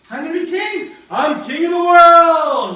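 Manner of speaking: high, building to a huge drawn-out yell; triumphant shout. Acted. Macbeth learns he will be king, building triumphant yell. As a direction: roar it out in hammed-up triumph.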